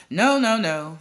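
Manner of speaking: cigar-chomping boss shouting down the audition. A narrator's reading. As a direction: bark the nos out. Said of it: "he shouted".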